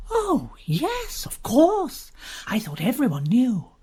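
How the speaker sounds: high, airy, mock-innocent; high teasing woman. Uziette needling Nigel about her sister, high and airy. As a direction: toss it off sweetly to sting him.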